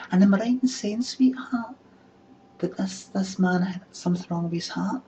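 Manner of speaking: high, loud camp voice; high camp gossip; camp gossipy man. Retold or imitated. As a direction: ask it like a camp host calling someone sweetheart. Camp voice question.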